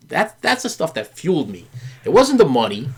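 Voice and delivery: ordinary delivery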